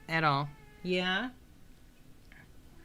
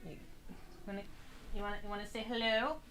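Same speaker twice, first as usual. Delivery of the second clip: cat talk; 4 semitones higher, 12 dB quieter, much faster than usual. Real, not acted. Streamer coaxing her cat to say hello, soft coo.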